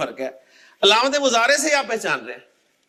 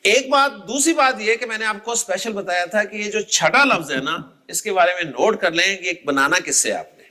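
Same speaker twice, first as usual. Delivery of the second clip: ordinary delivery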